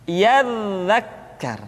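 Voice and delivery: slow "Yadzakkar" with sustained buzzing dz; flowing dza. Shown as a demonstration. Teacher repeating the correct form, flowing sound. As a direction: stretch the dz of "Yadzakkar" smoothly. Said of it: "suara dialirkan dengan lembut".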